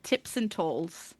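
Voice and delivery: sheepishly mangling «Tips and talls»; mumbled slip. Retold or imitated. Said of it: "And I said".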